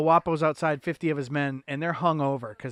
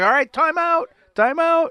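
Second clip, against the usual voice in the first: much higher, louder frantic voice; mock panicked plea; 14 semitones higher, 8 dB louder than usual. Friend acting out the panicked amigo, high and loud. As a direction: squeal it like a cowboy losing his nerve. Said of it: "the other guy's like".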